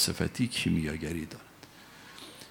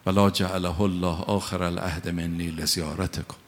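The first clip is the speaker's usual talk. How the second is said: solemn, lower chanted Arabic salutation; ziyarat recitation; 3 semitones lower, 3 dB louder than usual. Acted. Preacher leads the congregation in salutation, solemn chant. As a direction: intone it like a prayer.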